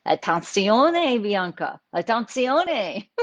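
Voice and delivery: put-on slow, loud Italian-accented warning. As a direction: imitate an Italian friend calling out. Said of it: "my Italian friend".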